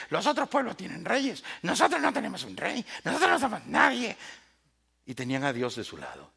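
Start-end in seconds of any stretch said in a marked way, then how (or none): 0.1-4.1 whiny complaining. Retold or imitated. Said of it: "empezó a llorar y a quejarse".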